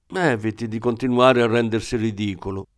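usual speaking voice

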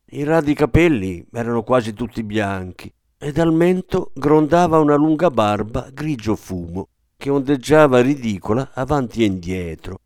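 ordinary delivery

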